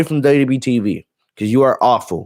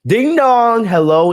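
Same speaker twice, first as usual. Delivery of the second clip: high sing-song ding dong hello; imitating Bayley; 9 semitones higher, slower than usual. Host picturing Bayley's cameo, high sing-song. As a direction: chime it like a doorbell. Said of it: "request that she does".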